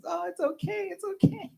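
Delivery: very high cooing mom impression; comforting mother. Mom hugging son after his timeout, exaggerated high soothing. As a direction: imitate a mother soothing her child. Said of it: "she'd give him a big hug".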